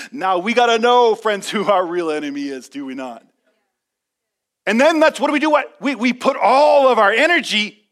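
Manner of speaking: loud, high preacher's call; full-throated sermon push; preaching climax. Acted. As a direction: rouse the church.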